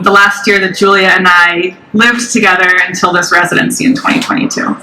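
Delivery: ordinary delivery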